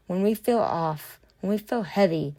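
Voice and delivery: usual speaking voice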